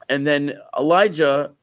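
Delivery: ordinary talk, nothing marked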